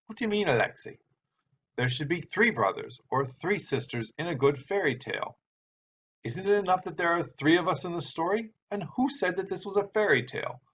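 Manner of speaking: put-on playfully brushing off the boy's complaint; teasing old uncle. Uncle Piter teasing children.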